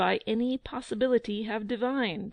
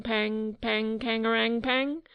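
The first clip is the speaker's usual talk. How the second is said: trumpet call; slower than usual. A narrator's reading. Trumpet fanfare imitated.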